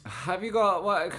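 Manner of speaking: high, loud and slow disbelief; surprised. Real, not acted. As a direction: blurt it out in disbelief. Surprised at a chat question.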